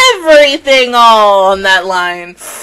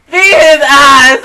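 slow emphatic shout; 3 semitones higher, 8 dB louder, slower than usual. Retold or imitated. Shouting the insult back at a troll, slow and loud. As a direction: bellow it out, slow and heavy.

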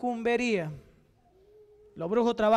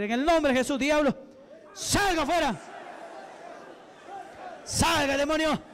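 exorcism preaching; 4 semitones higher than usual. Real, not acted. Preacher freeing a body from the devil, commanding. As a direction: command building to high, forceful orders.

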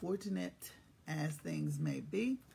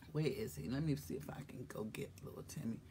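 whisper; 7 semitones lower, 10 dB quieter, faster than usual. Real, not acted. Muttered aside while calling.

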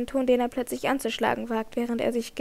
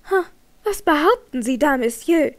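louder, catching her breath in shock; gasping; 3 semitones higher, 6 dB louder than usual. A narrator's reading. Christine gasps at Raoul's accusation, breathless. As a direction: gasp the words out, stunned. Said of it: "sagte die junge Frau keuchend".

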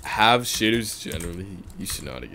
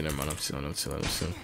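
normal talking voice

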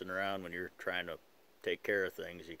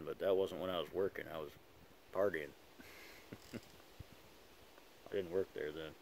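talking around pipe stem; clenched teeth speech; pipe in mouth. Real, not acted. Seen 3: Pipe stem clenched in teeth throughout; reference frames show pipe out.

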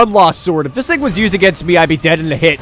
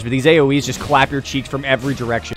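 usual speaking voice

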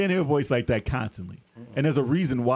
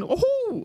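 very high cartoon "ooh"; imitated game-character whoop in falsetto; Mario's high whoop. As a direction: squeal out a video-game jump cheer. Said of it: "all you hear Mario ever saying".

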